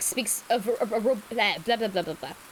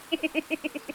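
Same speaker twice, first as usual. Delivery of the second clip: high giggle; 6 semitones higher, 4 dB quieter than usual. Real, not acted. Reaction laugh.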